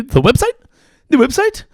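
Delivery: loud, high questioning repeat; mock disbelief. Comic echoing the press secretary's fumbled word, mock disbelief.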